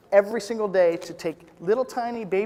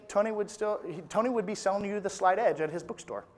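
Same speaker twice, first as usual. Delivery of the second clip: ordinary talk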